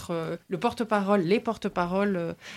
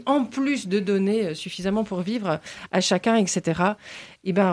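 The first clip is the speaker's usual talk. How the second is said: ordinary talk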